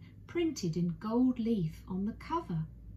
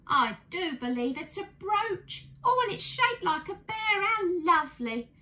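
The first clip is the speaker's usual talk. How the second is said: rising higher and louder with delight; a lady overjoyed by a bear-shaped brooch; excited, much higher, touched squeal; delighted exclaim; 11 semitones higher, 5 dB louder than usual. Acted. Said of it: "she exclaimed".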